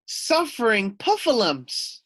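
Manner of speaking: high, loud screech. Acted. Puffin Muffin screeches at the news. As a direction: shriek it in dismay. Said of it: "screeched Puffin Muffin".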